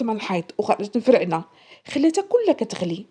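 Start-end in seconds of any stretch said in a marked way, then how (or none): none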